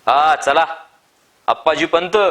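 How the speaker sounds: calling out loudly from the cart. A narrator's reading. Farmer calling to Appa at his door, loud call. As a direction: hail the house at full voice. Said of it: "मोठ्यानं हाळी दिली".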